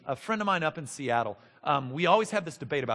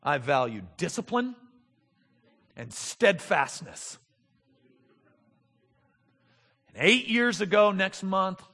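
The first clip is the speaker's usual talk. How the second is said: mock grim duty; 3 semitones higher, 14 dB louder than usual. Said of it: "begrudging submission example".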